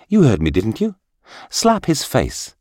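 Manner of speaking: high, sharp, insistent command; sharp order. A narrator's reading. Minister orders the astonished bodyguard, sharply. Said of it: "ordered the minister sharply".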